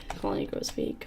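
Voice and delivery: whispered, ominous; eerie whisper. Shown as a demonstration. Player whispering the game's ominous warning. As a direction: breathe the warning with no voice. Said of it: "repeated after plain take".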